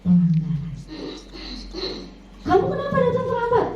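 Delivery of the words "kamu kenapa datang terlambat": much higher, fast scolding question; mock angry teacher demanding why late. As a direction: scold the late pupil sharply. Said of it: "nadanya tinggi atau keras".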